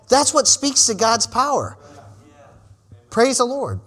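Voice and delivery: ordinary delivery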